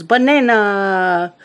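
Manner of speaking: drawn-out mock yell of a child; stretched-out, playful imitation yell; imitated yell. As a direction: yell it slowly, imitating an excited Minion fan. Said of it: "pops up out of the chair and yells".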